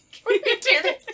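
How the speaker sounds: high, giggling plea through laughter; can barely get it out for laughing. Real, not acted. Breaks up laughing at intro.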